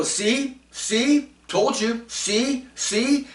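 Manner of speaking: high told-you-so taunt; mocking i-told-you-so. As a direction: gloat like a know-it-all. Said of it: "And people go".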